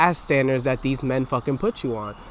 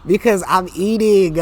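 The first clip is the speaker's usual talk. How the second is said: loud boast; 6 semitones higher, 7 dB louder, slower than usual. Real, not acted. Boasting loudly.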